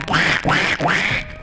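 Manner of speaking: excited quacking. Acted. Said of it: "I get excited and I go".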